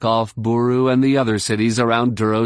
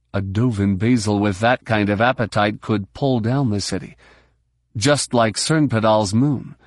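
usual speaking voice

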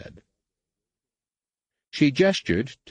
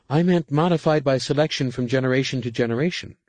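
higher, quick adept voice; higher, faster underling voice; adept clarifying; 8 semitones higher, 4 dB louder, faster than usual. A narrator's reading. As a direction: hurry to explain. Nenyim clarifies.

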